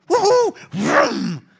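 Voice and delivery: loud high whoop then revving noise; gleeful cheer and vroom. Acted. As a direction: shout for joy and zoom away. Elijah runs off at once.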